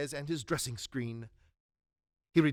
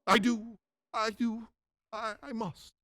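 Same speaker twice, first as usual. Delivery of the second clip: higher, stammering assent, dying away; frightened submission; 5 semitones higher than usual. Acted. Scrooge to the ghost, frightened submission. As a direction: give in hastily out of fear.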